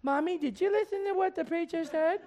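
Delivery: put-on child voice. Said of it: "The pastor preaches on anger".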